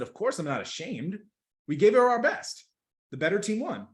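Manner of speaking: lightly acted emphatic retort; LeBron James. Athlete answering reporter about shame, mildly acted emphatic insistence. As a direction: raise pitch and push the key line out loud.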